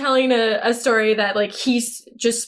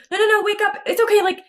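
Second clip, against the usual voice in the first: high, frantic plea to wake up; panicked pleading; 10 semitones higher, faster than usual. Retold or imitated. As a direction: beg him to keep breathing, half-acted. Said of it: "she is trying to talk to him and be like".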